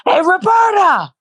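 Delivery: mock protest call. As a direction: hail her from a distance. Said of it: "where I'm like".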